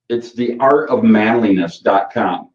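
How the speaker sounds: normal talking voice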